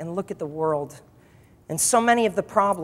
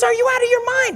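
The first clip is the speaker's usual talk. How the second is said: very high-pitched protest; acted incredulity; 13 semitones higher than usual. Agent protesting the owner's order, acted high incredulity. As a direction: question his sanity in mock disbelief.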